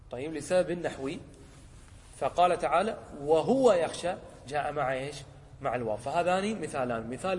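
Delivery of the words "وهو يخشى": وهو يخشى much louder, leaning on وهو; stress on وهو يخشى. Shown as a demonstration. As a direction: punch وهو يخشى out loud. Teacher highlights clause with waw.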